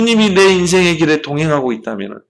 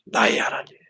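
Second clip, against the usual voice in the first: whispered confession of faith; intimate whisper; 9 dB quieter than usual. Real, not acted. Preacher to congregation, a private whispered aside. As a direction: breathe it to yourself. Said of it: "unvoiced aside".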